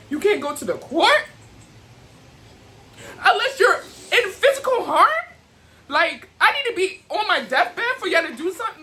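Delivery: bitter laugh cracking into tears; laughing through tears. Real, not acted. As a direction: voice climbs high, half laugh, half cry. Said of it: "After I'm getting emotional".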